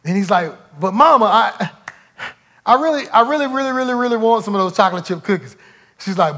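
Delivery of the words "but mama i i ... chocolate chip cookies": put-on higher, pleading little boy's whine; begging child. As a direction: plead like a kid desperate for a treat. Child begging mother for cookies.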